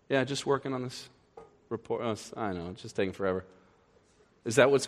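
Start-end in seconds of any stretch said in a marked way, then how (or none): 0.1-3.4 soft, low sheepish excuse; caught employee. Retold or imitated. Employee caught slacking makes a soft, sheepish excuse to the boss. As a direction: play innocent, quietly.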